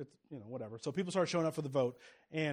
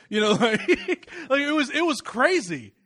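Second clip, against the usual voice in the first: laughing; 8 semitones higher, 10 dB louder, slower than usual. Real, not acted. Laughing at secretary quitting.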